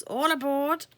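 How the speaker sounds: loud, high call. Acted. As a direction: call everyone aboard. Moth calls out.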